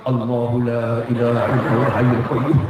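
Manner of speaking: low, far-off mock muttering with a laugh; lightly mocking wife muttering an incantation; mock mumbled incantation. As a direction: mumble it like a mock spell under your breath. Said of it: "Jangan kamu komat-kamit".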